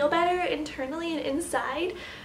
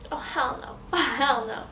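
ordinary talk, nothing marked

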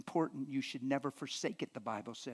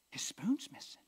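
whispered, higher, suspicious; wife whispering that the spoon is gone; 7 semitones higher than usual. Retold or imitated. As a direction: hiss it like a secret discovery.

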